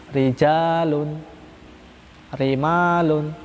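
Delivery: "Rijalun" and "rimalun" spoken slowly with a thin tapped r; thin light r. Shown as a demonstration. Teacher demonstrating thin r words for students. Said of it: "dia dibaca tarkik".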